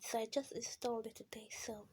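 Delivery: soft, fast unvoiced whisper; whispered aside. Real, not acted. Streamer explaining the reinstall to viewers, whispering. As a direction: drop to a whisper mid-sentence.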